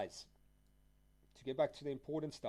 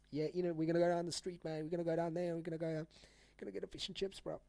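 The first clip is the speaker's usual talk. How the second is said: fast, higher mock British accent; 3 semitones higher, faster than usual. Shown as a demonstration. Streamer shows chat his British, playful British accent. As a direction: ham up a British bloke on the street. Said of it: "If I really speak, like, a little bit of British".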